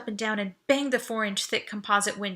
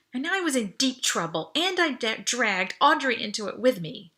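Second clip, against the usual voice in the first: ordinary delivery, nothing marked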